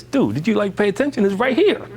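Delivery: high, exasperated disbelief at a coworker; like you can't believe they missed it. Retold or imitated. Speaker retelling a meeting to listeners, exasperated.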